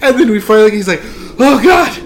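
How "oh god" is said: high, loud cry of disgust; hammed-up suffering from a stench; agonized groan. Friend suffering from smell, acted.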